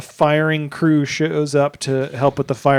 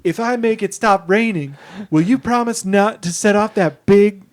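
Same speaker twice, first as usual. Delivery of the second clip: a lightly raised, gentle mock dad voice, higher in pitch; dead dad's voice; 6 semitones higher, 4 dB louder than usual. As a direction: pretend to be her late father and comfort her. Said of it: "doing a voice and just being like".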